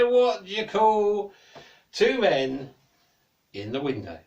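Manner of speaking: loud, slow joke setup; milk the joke slowly; hammed-up jester; jester's joke. A narrator's reading.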